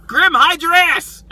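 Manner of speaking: high, loud, drawn-out holler; comic cry to take cover; mock warning shout. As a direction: yell a hammed-up warning. Acting out a panicked warning.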